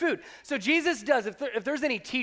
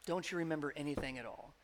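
soft repeat; 7 semitones lower, 11 dB quieter, faster than usual. Acted. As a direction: lower and much softer, almost to himself.

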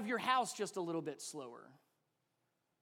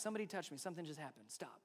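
hushed realization; 4 semitones lower, 10 dB quieter, faster than usual. Retold or imitated. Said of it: "he recognized".

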